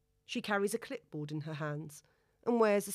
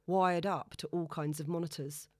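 ordinary delivery, nothing marked

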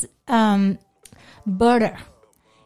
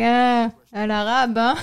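slow, scornful French jab; a touch of mock contempt; sneering. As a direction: look down your nose at her. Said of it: "in France, they're like".

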